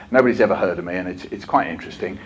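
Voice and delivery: ordinary talk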